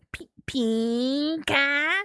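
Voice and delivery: "pinka" stretched very long and high; slow, high, sustained "pinka"; playfully drawn-out word. Pretend. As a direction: draw out the first vowel of "pinka". Playing with the word pink.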